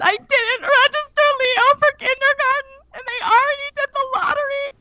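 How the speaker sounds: high crying wail; crying to her friend at the coffee shop, high and tearful; bawling. Retold or imitated. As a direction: sob it out, high. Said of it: "And I just start bawling".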